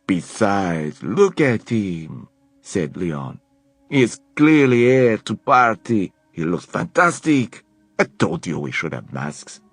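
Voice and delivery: put-on slow, amused French accent; teasing French accent. A narrator's reading. Leon teasing Helen about Alec, French accent. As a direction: admire him playfully. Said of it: "a French accent".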